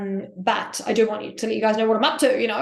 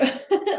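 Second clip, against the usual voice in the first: happy giggle; 10 semitones higher than usual. Real, not acted. Happy about pizza.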